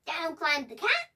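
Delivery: plain reading voice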